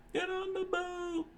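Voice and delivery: very high panicked yell; screaming crowd. A narrator's reading. Crowd flees the restaurant, high panicked yell. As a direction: scream to flee. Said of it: "the crowd screamed out".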